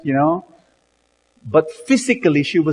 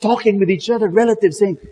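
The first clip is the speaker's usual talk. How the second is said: ordinary talk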